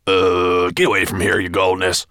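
loud, urgent servant warning; alarmed cry. A narrator's reading. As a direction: cry out in fright. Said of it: "cried Gravelbeard".

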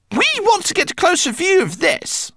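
high rat pilot voice; Lola the rat. A narrator's reading. Lola answers Twigleg in her high rat voice. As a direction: mull it over, rat-like.

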